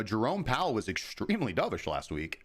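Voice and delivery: usual speaking voice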